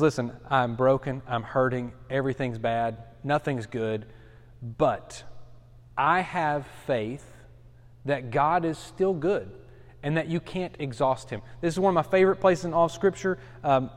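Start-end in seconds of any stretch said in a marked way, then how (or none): 0.0-11.4 quiet faith. Retold or imitated. Preacher voicing Jeremiah broken yet hopeful, low and gentle. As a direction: confide it gently, hurt but trusting.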